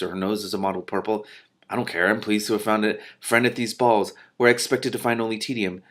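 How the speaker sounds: plain reading voice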